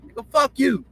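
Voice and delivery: put-on pitched high like a loud young kid; a young boy's high mock taunt. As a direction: toss out "fuck you" high and sharp, kid-style. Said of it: "you got a lot of voice when you're kids".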